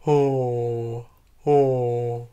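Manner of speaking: low yawning oh sounds; yawny low oh. Shown as a demonstration. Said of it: "lower it with a yawn".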